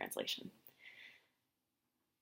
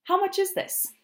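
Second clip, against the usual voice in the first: much higher, louder shopper voice; mock shopper; 6 dB louder than usual. Tourist asking a shopkeeper the price, hammed-up. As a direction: play an eager shopper.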